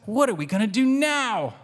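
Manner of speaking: high, bright kitten voice asking; Pancake. A narrator's reading. Kitten asking her family what now, high and lost. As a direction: lift the voice way up, little kitten at a loss. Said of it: "Pancake, with her hands up, says".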